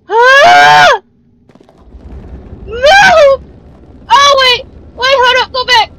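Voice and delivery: high frantic screams of fear; panicked shrieks. Real, not acted. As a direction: shriek in real panic, then beg. Monster appears, panicking.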